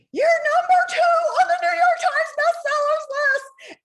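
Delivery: very high, loud ecstatic scream. Retold or imitated. Editor screaming the bestseller news at the author. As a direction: shriek the news down the phone. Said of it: "she screams at me".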